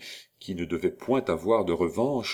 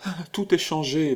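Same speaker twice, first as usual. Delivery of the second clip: higher-pitched, mournful lament; dejected; 9 semitones higher than usual. A narrator's reading. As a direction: grieve that all has changed. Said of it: "prononça-t-elle d'une voix morne". (voix morne)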